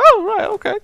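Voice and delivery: squeaky high-pitched mock reply; shrill-free falsetto mock "oh right okay"; mock high squeak. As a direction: pitch it way up like a cartoon. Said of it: "I was like".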